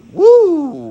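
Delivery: loud, high whoop of delight; excited whoop. Real, not acted. Whooping at the thought of downtown Toronto women, loud and high. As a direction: let out a big excited "Woo!".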